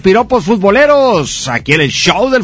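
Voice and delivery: upbeat radio sponsor read. Acted. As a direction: sell it like a radio spot. Host reads sponsor ad.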